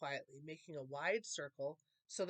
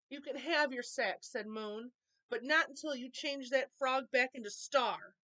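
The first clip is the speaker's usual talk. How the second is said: firm, brave; 6 semitones higher, 5 dB louder than usual. A narrator's reading. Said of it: "His strong words".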